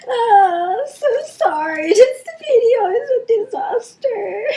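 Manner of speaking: crying and apologizing, voice high; real tears breaking through the words; snotty crying. Real, not acted. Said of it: "ugly crying in front of the people".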